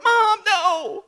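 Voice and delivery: high, whiny plea to mom; mock hurt kid whining at mom; pleading with mom after her insult, whiny and high; whiny protest. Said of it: "you're like".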